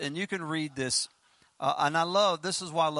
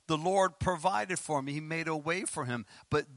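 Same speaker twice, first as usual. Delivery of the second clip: ordinary delivery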